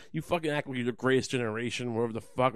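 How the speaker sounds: normal talking voice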